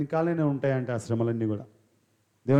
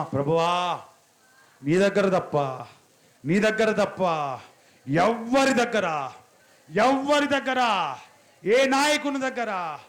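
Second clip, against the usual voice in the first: preaching at full force; 3 semitones higher, 3 dB louder, slower than usual. Acted. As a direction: build each phrase like a rising appeal. Leads prayer, builds intensity.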